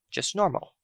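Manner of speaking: ordinary delivery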